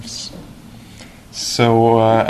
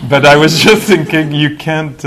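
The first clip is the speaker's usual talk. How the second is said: laughing; 5 semitones higher, 10 dB louder than usual. Real, not acted. Laughing at own failed translation.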